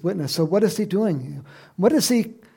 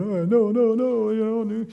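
mock protest; 6 semitones higher, 4 dB louder than usual. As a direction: imitate someone fussing in objection. Mimics the indignant leaders protesting.

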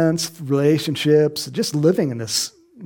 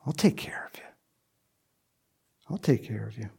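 soft, low reassurance; Jesus soothing the thief; tender; 6 semitones lower, 8 dB quieter, faster than usual. Acted. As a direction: comfort him gently. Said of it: "fulfilled that promise".

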